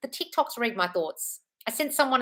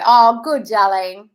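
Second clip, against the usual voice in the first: louder, bright "oh good"; real gushing praise; delighted; 2 semitones higher, 11 dB louder, much slower than usual. Real, not acted. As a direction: cheer the viewer on. Praising a viewer's good news.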